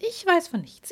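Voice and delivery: high, coy fairy voice; coy fairy denial. Acted. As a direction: play innocent, sing-song high. Fairy denies knowledge.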